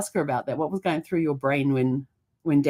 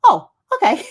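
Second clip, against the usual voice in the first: much higher, louder laughing reply; laughing niece; 9 dB louder than usual. Retold or imitated. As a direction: squeak it out through a laugh. Niece voiced in retold story.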